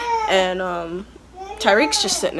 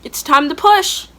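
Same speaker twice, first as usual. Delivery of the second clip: nurse's call; 7 semitones higher, 8 dB louder than usual. Retold or imitated. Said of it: "checks me, and goes".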